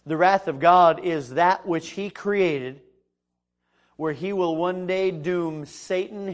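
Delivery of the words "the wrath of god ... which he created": usual speaking voice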